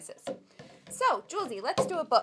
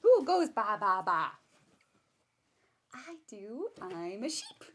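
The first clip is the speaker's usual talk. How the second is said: put-on reading to a baby; 5 dB louder than usual. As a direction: draw the baby in with each animal. Reading picture book to baby.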